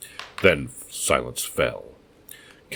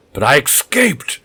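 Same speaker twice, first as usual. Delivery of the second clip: much higher, much louder, slow triumphant scream; 12 semitones higher, 9 dB louder than usual. Acted. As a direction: scream your victory. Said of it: "The scream rose triumphantly".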